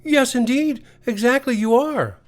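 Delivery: high little-creature voice, mock surprise; small creature Teddy, fussy and polite. Teddy's voice in the story.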